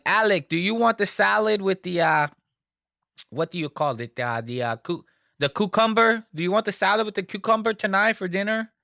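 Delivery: high, quick mock Spanish accent; fake Spanish accent. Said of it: "his wife with her fake Spanish accent".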